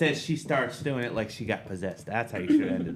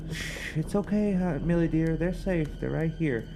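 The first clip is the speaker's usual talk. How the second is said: soothing mother; 6 semitones higher than usual. Acted. Mother voiced soothing Millie.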